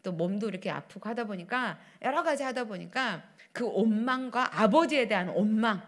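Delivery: ordinary talk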